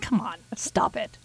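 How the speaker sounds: whispered brush-off. Retold or imitated. Host mock-dismissing people shocked by cat kisses, whispered.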